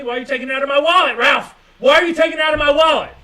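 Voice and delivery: loud, fast, high accusing yell; mock angry yell. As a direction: yell at Ralph for stealing from your wallet, fast. Acted quarrel with Ralph.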